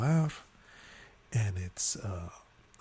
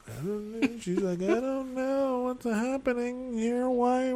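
high, drawn-out feigned ignorance; put-on innocent attitude; 11 semitones higher than usual. Bride's mother denying any blame, mock innocence. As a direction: mimic someone playing clueless, slowly and high. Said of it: "with that attitude".

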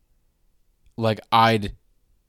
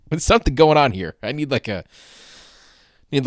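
normal talking voice

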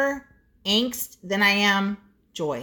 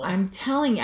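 ordinary delivery

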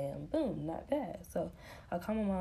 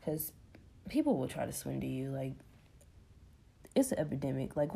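normal talking voice

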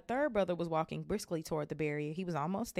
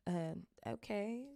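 hesitant nervous; 4 semitones higher, 5 dB quieter, slower than usual. Acted. Nervous Harry agrees.

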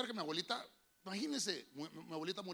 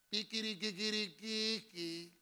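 imitated bells; 2 semitones higher, much slower than usual.

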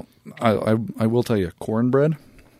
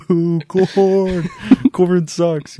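loud, high jeering boo; 10 semitones higher, 8 dB louder, much slower than usual. Retold or imitated. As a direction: jeer it like an angry fan. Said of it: "I scream profanities".

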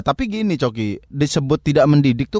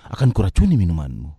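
a hint of a scheming soap villain; soap inner monologue; 10 semitones lower than usual. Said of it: "ngomong dalam hati dulu".

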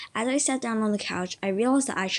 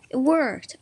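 higher, louder narrated yell; 6 semitones higher, 5 dB louder than usual. A narrator's reading. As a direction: call it out with a lightly raised voice. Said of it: "I yelled".

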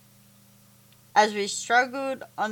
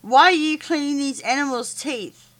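indignant yell; 6 semitones higher, 4 dB louder than usual. A narrator's reading. Boy confronts Monty.

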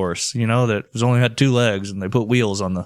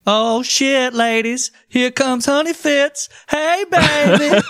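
high, drawn-out flirty call; hammed-up hooker cooing at a client; slow, high-pitched come-on; catcalling streetwalker; 13 semitones higher, slower than usual. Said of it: "sounds like something a hooker would call".

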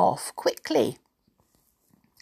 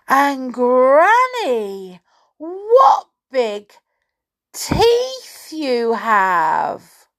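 high, very slow, loud amazement; hammed-up storybook astonishment; alarmed little girl; 8 semitones higher, 9 dB louder, much slower than usual. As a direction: draw out every word with mounting dread. Girl marvels, growing alarm.

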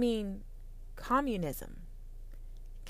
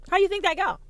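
much higher, a bright mocking question; high mocking take; 8 semitones higher, faster than usual. Shown as a demonstration. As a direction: lift it high like a taunt.

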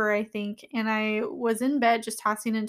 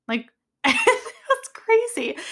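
laughing; 12 semitones higher, 4 dB louder, much slower than usual. Real, not acted. Laughing at her own story.

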